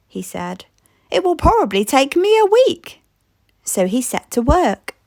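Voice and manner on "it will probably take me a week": put-on eager boy's voice. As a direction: chirp "It will probably take me a week" like an excited little boy. Ben echoes Gran's words.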